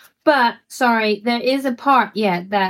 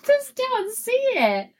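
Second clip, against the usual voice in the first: laughing disbelief; 12 semitones higher, slower than usual. Real, not acted. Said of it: "laughing high disbelief".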